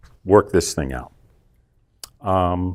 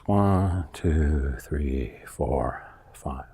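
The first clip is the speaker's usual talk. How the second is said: counting down, fading softer and lower to almost nothing; slow counting on the exhale; 9 semitones lower, 7 dB quieter, much slower than usual. Shown as a demonstration. Coach demonstrating the breathing count, fading count. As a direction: let each number sink away with the breath. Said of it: "exhale for five seconds".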